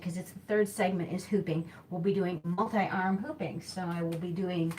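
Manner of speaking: ordinary talk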